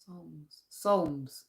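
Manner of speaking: quiet "Psalms" as "sawmz"; practising the word. Shown as a demonstration. Scottish vlogger drilling a hard word, quiet. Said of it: "a mixture of both".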